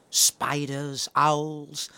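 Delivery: ordinary delivery, nothing marked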